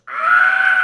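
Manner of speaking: very loud harsh mock scream. Lena burning against the wall, agonized mock scream. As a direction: scream long and raw, as if in agony. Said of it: "she's just screaming".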